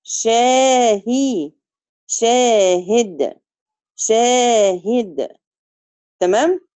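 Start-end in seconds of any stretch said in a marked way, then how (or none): 2.1-5.4 شاهد slow, short i on the هاء; شاهد, short i under the هاء; short kasra demo. Shown as a demonstration. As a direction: say شاهد with a half-length i. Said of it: "كسر الهاء".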